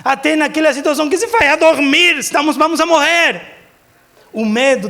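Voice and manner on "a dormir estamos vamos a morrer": raised, higher-pitched protest; retold yelling. Preacher retells disciples waking Jesus, mock yell. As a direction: cry out in mild mock panic. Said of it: "gritaram com ele".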